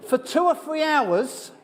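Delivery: high, slow, laughing through the words; "for two or three hours" stretched high on a laugh. Real, not acted. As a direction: giggle it out, amused at himself. Laughing at his own Cockney cramming.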